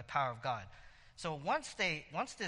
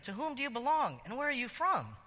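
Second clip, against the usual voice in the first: a lightly raised questioning David; voicing David; 8 semitones higher than usual. David questions the Egyptian, lightly higher voice. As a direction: ask it up high like David.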